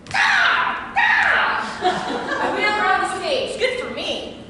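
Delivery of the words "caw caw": piercingly high, loud cawing; crow caw. Acted. Performer takes the crow's part, high shouted caws. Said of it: "I'll play the crow".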